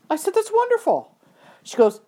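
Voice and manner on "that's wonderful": high-pitched retold praise; delighted congratulations. Trainer congratulates rider on first show, high and delighted.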